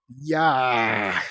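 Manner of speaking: loud battle yell; loud yell while lunging; shout. Acted. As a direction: charge in with a yell. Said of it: "Clay shouted".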